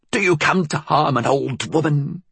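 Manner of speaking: put-on high-pitched old woman, venomous; high, vicious old-crone voice; vicious hiss. A narrator's reading. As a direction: hiss it as an accusation. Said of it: "she hissed, swift, vicious".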